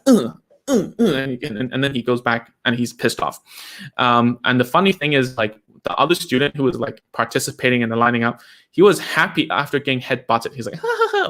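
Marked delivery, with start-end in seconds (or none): 0.1-1.0 short, higher-pitched grunts, one per butt; imitated headbutting grunts. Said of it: "So he goes up and goes". 10.8-11.3 high-pitched giggle imitating a pleased child; imitated happy laugh. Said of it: "like that".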